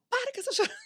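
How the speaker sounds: high, laughing scold; bossy friend. Retold or imitated. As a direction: brush off the tears with a laugh. Friend scolds crying woman.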